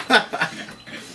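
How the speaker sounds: a real burst of airy, high laughter; high breathy laugh. Real, not acted. Cracking up after tripping over the words, real laugh. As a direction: laugh out the embarrassment.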